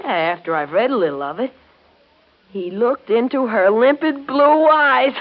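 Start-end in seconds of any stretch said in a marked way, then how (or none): 2.5-5.1 mock swooning reading. Brother teasing his twin over her love story, mocking swoon. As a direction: ham up the mushy line to tease.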